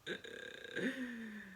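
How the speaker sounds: quiet self-amused chuckle; a little laugh at herself; soft breathy chuckle. Real, not acted.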